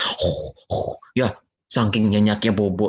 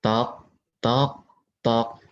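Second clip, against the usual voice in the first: knocking sounds; 3 dB quieter, slower than usual. Shown as a demonstration. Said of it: "ketuk pintu".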